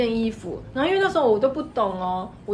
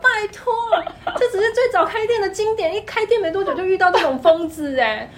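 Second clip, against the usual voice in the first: exasperated outburst; 9 semitones higher, 6 dB louder than usual. Real, not acted. Exasperated recounting of crazy customers.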